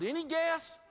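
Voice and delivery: ordinary delivery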